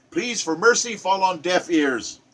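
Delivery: ordinary delivery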